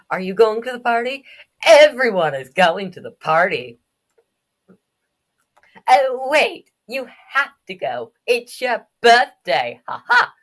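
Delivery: high, loud, excitable taunting; teasing kid. Acted. Kid pestering birthday boy about the party, teasing. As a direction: crow it out and end on a high laugh.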